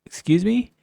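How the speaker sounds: high, sing-song "Excuse me"; mock polite excuse me. Polite request quoted to cashier.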